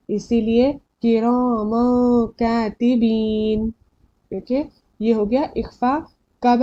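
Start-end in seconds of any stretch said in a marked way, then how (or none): 1.0-3.7 किरामन कातिबीन drawn out, n of किरामन hidden nasally; slow किरामन कातिबीन with a held nasal hum before क; ikhfa recitation. Shown as a demonstration. Teacher concluding the example, slow nasal hold. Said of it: "इख्फा में से है".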